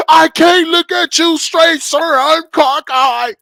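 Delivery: high, slow, dopey reply; slow goofy recruit. Retold or imitated. Recruit answering the sergeant.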